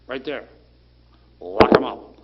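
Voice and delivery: imitating a judge, barking the order loud; stern judge. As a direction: judge pointing, ordering the arrest. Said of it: "said to the bailiff".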